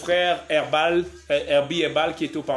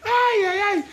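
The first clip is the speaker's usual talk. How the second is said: exclamation; 6 dB louder than usual. Real, not acted. Mock pained exclamation.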